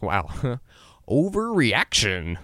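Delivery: slow and amused, a laugh before the word; laughing at himself. Retold or imitated. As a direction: chuckle out overreaction slowly. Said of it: "I shrug it off and think".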